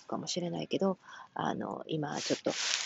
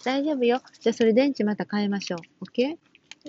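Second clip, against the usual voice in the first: higher, louder, reassuring mom voice to her little one; 7 semitones higher, 5 dB louder than usual. Real, not acted. Mother reassures child mid-broadcast. (子供をなだめる明るい声)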